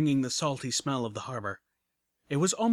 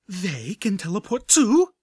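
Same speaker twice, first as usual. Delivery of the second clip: higher, louder, slow disbelieving blurt; shocked blurt; 7 semitones higher, 8 dB louder, slower than usual. A narrator's reading. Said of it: "Velvet Remedy blurted".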